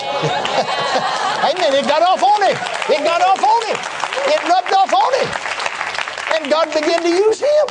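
high, strained preacher's cry; full-force preaching. Real, not acted. Preacher exulting to the congregation over a converted critic, fervent high-pitched preaching. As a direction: drive each repeat home from high up.

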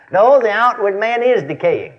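usual speaking voice

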